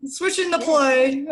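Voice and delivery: high, loud, drawn-out coach's call; mock sideline call. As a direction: call it slowly across the field. Jokingly imitates a sideline soccer call.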